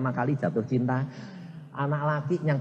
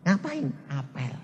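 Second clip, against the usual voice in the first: normal talking voice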